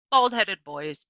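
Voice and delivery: ordinary delivery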